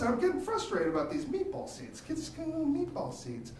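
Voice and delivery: ordinary talk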